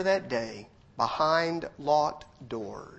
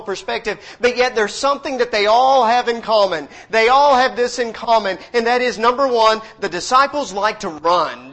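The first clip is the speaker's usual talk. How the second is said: loud, high, driving sermon; full-force preaching; 7 semitones higher, 9 dB louder than usual. Real, not acted. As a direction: push the point home to the church.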